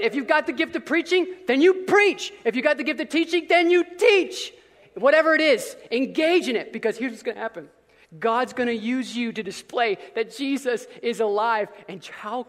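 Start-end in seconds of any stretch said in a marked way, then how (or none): none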